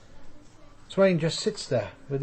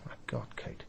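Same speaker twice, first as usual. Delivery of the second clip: barely voiced dismay; soft weary exasperation; breathed out; 5 semitones lower, 21 dB quieter than usual. Real, not acted. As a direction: sigh it out under the breath. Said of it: "dismayed sigh".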